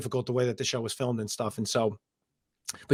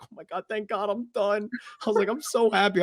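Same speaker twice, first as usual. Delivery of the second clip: relieved joy; 7 semitones higher, 3 dB quieter than usual. Retold or imitated. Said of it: "crying tears of joy".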